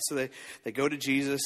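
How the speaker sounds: ordinary talk, nothing marked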